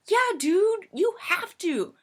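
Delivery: high, loud acted pleading; pressing a stubborn friend; exasperated insistence. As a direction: insist as if it's obvious. Arguing with friend about cheese.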